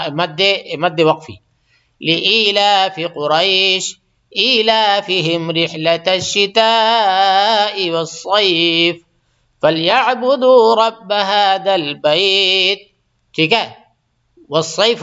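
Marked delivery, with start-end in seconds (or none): 2.0-12.8 slow, drawn-out chanted tilawat; tajweed recitation; Quran recitation. Acted. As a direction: recite it melodically, stretching the long vowels. Recites Quran between explanations.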